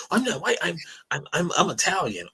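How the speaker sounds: high mock voice of the Italian kid. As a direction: whine it high, insisting. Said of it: "I'd be like".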